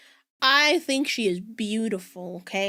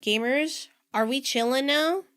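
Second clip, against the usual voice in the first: usual speaking voice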